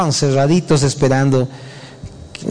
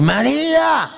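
tender call; 11 semitones higher, slower than usual. Acted. Jesus calls Mary by name, tender and high. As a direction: say her name like a gentle call.

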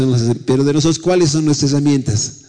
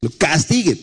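mock angry father; 8 semitones higher than usual. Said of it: "algunos papás deben decir".

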